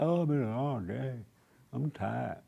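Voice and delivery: soft, worn-out husband whine; mock a husband dragging home tired. Preacher mimics a husband home from work, softly tired. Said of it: "he come home tired, he all mad".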